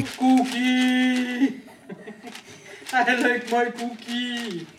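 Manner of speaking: goofy Cookie Monster puppet voice; loud, slow, hammed-up cookie craving; goofy muffled sock puppet. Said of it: "makes it say".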